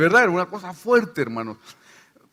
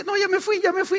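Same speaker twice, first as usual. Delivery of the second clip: much higher, mocking demon insisting it left; lying demon voice; 13 semitones higher than usual. Lying demon fooling the deliverance team: much higher mock voice. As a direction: squeak it out like a lying spirit.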